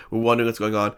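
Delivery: usual speaking voice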